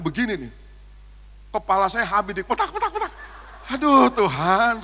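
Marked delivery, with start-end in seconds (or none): 2.7-3.1 mock smacking sounds. Mimics hands hitting his head. 3.7-4.8 loud, slow mock groan to God; pained outcry. Pastor being hit on the head, crying out.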